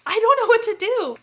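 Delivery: high, helpless whine; exaggerated high-pitched floundering; mock helpless. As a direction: play clueless and stuck, pitched well up. Retold helpless floundering with the box.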